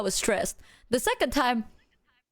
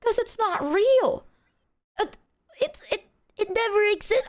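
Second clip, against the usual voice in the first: exasperated denial; 11 semitones higher than usual. Retold or imitated. Answering why they never told her, exasperated denial.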